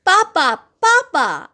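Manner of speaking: high, loud call for help; shouting. A narrator's reading. Girl calling her father as bandits approach, shouting. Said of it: "I shouted".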